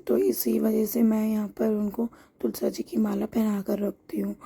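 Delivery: ordinary talk, nothing marked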